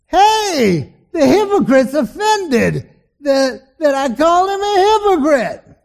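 loud sneering mock yell; jeering poker host. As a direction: crow it to your friends, slow and sneering. Poker host jeers at pastor.

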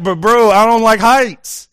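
telling the co-leader he hates heights, loud whiny protest; exasperated plea. Retold or imitated. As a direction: whine it at your buddy, scared of heights. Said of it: "And I'm like".

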